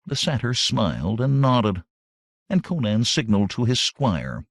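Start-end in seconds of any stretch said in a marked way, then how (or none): none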